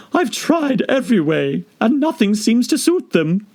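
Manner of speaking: very high bird voice with a hint of a sob; fretful, near tears; sobbing pigeon. Pigeon complaining to Alice with a mild sob. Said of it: "added with a kind of sob".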